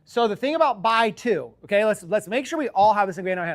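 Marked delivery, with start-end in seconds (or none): none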